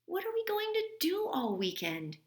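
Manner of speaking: put-on high whiny kid voice; high child's voice, moping. A narrator's reading. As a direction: ask like a bored little boy. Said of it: "Henry asked".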